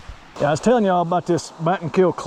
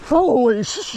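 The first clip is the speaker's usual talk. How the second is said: startled outburst; 7 semitones higher, slower than usual. Real, not acted. Spots a timber rattler.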